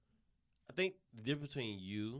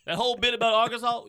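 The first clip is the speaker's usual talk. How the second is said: high, loud mock gushing; exaggerated high-pitched yell of delight; hammed-up fan raving; 12 semitones higher, 15 dB louder than usual. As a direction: shout it like an overwhelmed fan. Same acted fan raving.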